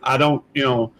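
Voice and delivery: usual speaking voice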